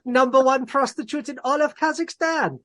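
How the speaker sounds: put-on high, loud Kazakh accent like Borat; Borat impression. Slipping into Borat for the cohost, hammed-up Kazakh accent. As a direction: ham up the Borat voice. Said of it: "I slipped into Borat".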